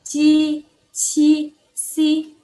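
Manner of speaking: "ci, ci, si" on a high level pitch; high sustained syllables for repeating; consonant drill. Shown as a demonstration. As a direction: hold each syllable long and even. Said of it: "kita baca bareng-bareng".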